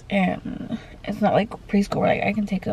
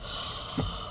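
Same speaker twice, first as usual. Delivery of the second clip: sudden gasp; 14 dB quieter than usual. Real, not acted. Realizes how little time left.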